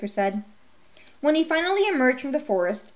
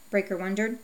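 ordinary delivery

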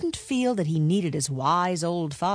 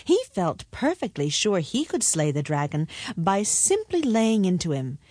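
ordinary delivery

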